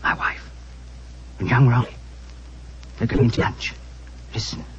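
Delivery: soft urgent whispering; hushed whisper. Acted. Husband hushes the doctor while eavesdropping on his wife, whispered. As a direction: keep it down so they don't hear. Hiding, overhearing wife on landing.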